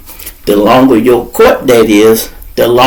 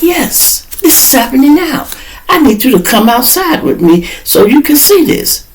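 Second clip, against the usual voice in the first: insistent lawyer; 12 semitones higher than usual. Retold or imitated. Lawyer insisting, voiced high.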